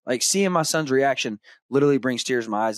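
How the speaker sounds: normal talking voice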